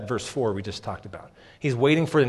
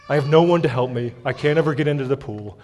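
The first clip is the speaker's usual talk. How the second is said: louder, whining self-pity; whiny complaint; 6 dB louder than usual. Retold or imitated. The old sick man complaining to Jesus, mock whiny. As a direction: play the sick man moaning that nobody helps him. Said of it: "this guy's tone of voice".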